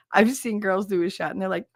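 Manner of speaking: ordinary talk, nothing marked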